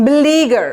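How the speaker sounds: "বিলিগার" with a long, stressed middle ee; careful pronunciation. Shown as a demonstration. Teacher asking learners to note the pronunciation, stretched "লি" in "বিলিগার". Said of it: "উচ্চারণটা খেয়াল করেন".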